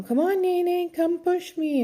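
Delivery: put-on high, childlike pleading voice; a little boy begging his aunt to play; nephew's voice. Aunt imitating her five-year-old nephew begging her to play, high and childlike. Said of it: "Lincoln's always like".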